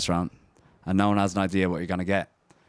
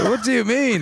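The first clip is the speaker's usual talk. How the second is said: high, loud, laughing protest; his idea called terrible, a high laughing protest; 7 dB louder than usual. Real, not acted. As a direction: laugh it off while defending the idea. Friend mocks his idea.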